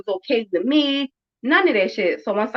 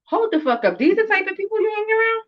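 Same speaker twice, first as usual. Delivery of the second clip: rising to a much higher outraged question; mock disbelief; 10 semitones higher than usual. Mother retelling scolding her daughter in high outraged disbelief. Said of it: "I'm like".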